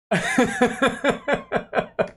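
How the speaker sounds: breathy rising giggle; a light, rising laugh. Real, not acted. Streamer still laughing at the taunt, light giggle.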